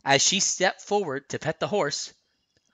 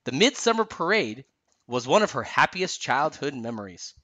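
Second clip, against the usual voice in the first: ordinary delivery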